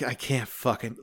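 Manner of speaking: mock weary giving up; retold sighing exasperation. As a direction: act slumped and sighing. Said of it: "sighing, like".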